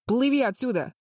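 urgent high shout. A narrator's reading. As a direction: yell it out in alarm. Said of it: "закричал ему Дич". (тревожный крик)

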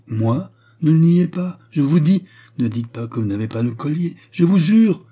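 high, loud, insistent exchange; urgent protest. A narrator's reading. Lovers clash over paying for the necklace, urgent and loud.

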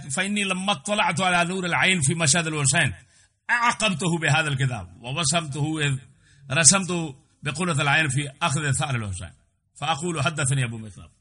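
fast recitation of the quoted text; rapid Arabic reading. Acted. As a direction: rattle off the Arabic passage quickly. Reads Arabic text aloud.

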